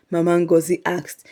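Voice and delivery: plain reading voice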